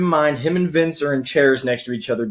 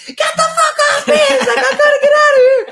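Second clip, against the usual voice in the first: put-on very high, louder girly scream; snapping shout; 5 dB louder than usual. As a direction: shriek it in a panic, half laughing. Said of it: "And Ian snaps".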